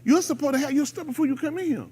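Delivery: high, scolding teacher. Retold or imitated. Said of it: "They be like".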